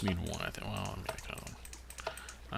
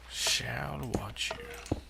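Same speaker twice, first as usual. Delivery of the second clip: slow, sinister whisper; creepy whisper; 2 semitones higher, much slower than usual. Acted. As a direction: whisper it like a villain. Creepy line to mobs.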